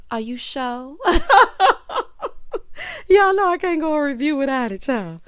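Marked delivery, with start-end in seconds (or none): 1.0-3.0 high, loud burst of laughter; real big cackling laugh. Real, not acted. Reviewer cracking up mid-recap, laughing loudly. 3.1-5.2 high and loud, straight out of a laugh; loud playful outburst. Real, not acted. Reviewer owning up to viewers after a laughing fit, loud and playful.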